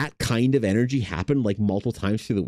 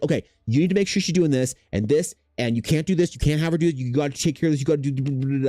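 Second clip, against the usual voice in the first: higher, bossy mock lecturing; finger-in-face lecture; 6 semitones higher, faster than usual. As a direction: wag a finger and boss him around, playfully. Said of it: "finger in face".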